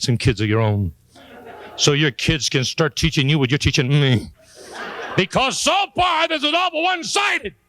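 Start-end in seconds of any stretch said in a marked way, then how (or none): 5.2-7.6 exasperated complaint. Acted. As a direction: complain in a stretched, squealing high voice. Father's mock complaint to his kids.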